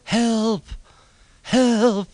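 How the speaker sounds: high, loud distant cries for help; cry for help. A narrator's reading. Thomas buried in snow calling for rescue, high distant cries. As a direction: shout for help from far under the snow. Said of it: "there came a muffled cry".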